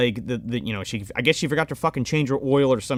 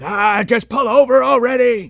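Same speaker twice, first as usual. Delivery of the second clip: louder, raised-pitch impatient holler; mock exasperated yell; 6 semitones higher, 5 dB louder than usual. Villain bumping the boat in a chase, mock impatient yell. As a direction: imitate an exasperated pursuer calling ahead. Villain ramming the car, yelling.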